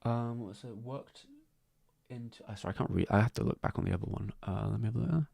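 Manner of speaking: absent-minded murmur while looking something up; muttering to self. Real, not acted. Streamer checking an earlier post for viewers, muttering to self.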